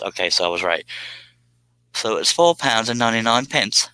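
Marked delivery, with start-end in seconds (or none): 1.9-3.9 a hammy, put-on British accent on the price; reading the price like a Londoner, half joking; mock British accent. Streamer reading a British donation amount, in mock British accent. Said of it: "I can't say that in a normal accent".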